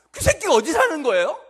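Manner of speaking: furious shout, high and loud; enraged outburst. Acted. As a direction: explode as David loses his temper. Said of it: "뚜껑이 딱 열리고". (뚜껑 열린 다윗의 분노한 고함)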